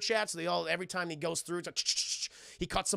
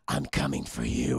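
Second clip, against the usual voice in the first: much lower, hammed-up gravel threat; mock tough-guy threat. Logan threatening Stryker over intercom, low mock growl.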